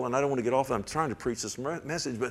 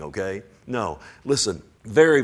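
usual speaking voice

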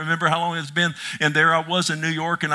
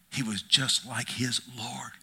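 hushed; 5 semitones lower, 7 dB quieter than usual. Acted. Quiet follow-up to Jesus wept.

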